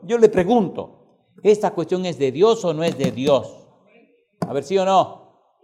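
preaching loud and building; predicación enérgica. Acted. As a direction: preach it out to the congregation. Predica con fuerza. (predicando con fuerza a la congregación)

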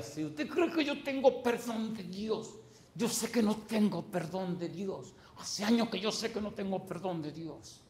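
voz aguda y teatral de matón; asesino amenazante. Retold or imitated. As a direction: imita al sicario desafiante. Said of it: "pensó que me iba a meter miedo con esa mojiganga". (imitación exagerada del sicario)

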